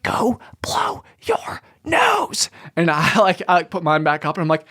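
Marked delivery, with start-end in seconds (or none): 0.0-2.5 slow, forceful stage whisper; imitating a classmate's pointed whisper. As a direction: whisper it at someone, word by word. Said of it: "I'm whispering at you".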